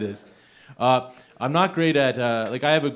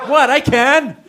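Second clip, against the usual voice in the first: much higher and louder, laughing defiance; defiant shout; 13 semitones higher, 12 dB louder, slower than usual. Real, not acted. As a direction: shout it back at the crowd. Defiant reply to the audience.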